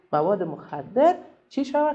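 normal talking voice

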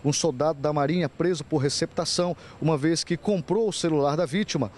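crisp, emphatic TV reporter read; measured, punchy reporting delivery; news report narration. Acted. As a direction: read it as a crime news voice-over.

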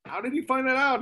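high, amazed question. Retold or imitated. Tom Brokaw marveling at the researcher's finds, high amazed. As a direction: pitch it way up in disbelief.

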